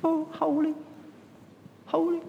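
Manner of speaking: high, soft, breathy-free sigh of holy; a wimpy half-hearted holy; high soft mock sigh of holy; mock limp praise. Shown as a demonstration. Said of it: "It's not kind of".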